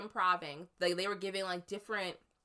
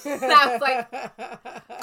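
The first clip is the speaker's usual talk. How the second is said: laughing; 4 semitones higher, 14 dB louder, much slower than usual. Real, not acted.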